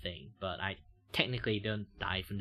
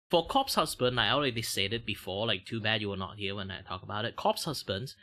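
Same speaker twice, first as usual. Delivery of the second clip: normal talking voice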